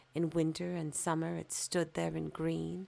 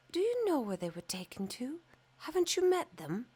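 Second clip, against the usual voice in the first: eager, a little higher, quick questions; lightly voiced young fir tree; 9 semitones higher, faster than usual. Young fir tree asking the swallow and stork, quick and eager. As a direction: ask the birds fast and hopefully.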